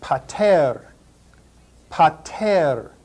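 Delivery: pater as PAH-tair, aspirated p; pater, teacher demo; Greek pronunciation. Shown as a demonstration. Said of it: "That's the word for father".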